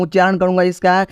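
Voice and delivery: ordinary talk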